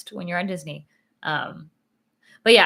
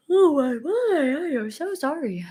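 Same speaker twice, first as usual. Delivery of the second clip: stretched through a big yawn; 8 semitones higher, 4 dB louder than usual. Real, not acted. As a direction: apologise mid-yawn. Yawning apologising.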